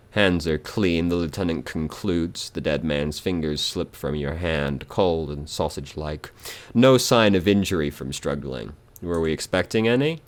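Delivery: ordinary delivery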